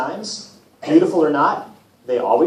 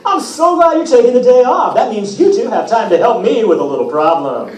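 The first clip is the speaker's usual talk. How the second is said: higher, louder upbeat god voice; cheery Apollo; 5 semitones higher, 5 dB louder than usual. Acted. Apollo asks Percy and Grover for help, bright and showy. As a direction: sell the favour brightly. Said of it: "Apollo said".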